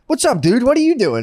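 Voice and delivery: high, eager dude voice; lightly voiced stranger. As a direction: chirp it like an overfriendly stranger.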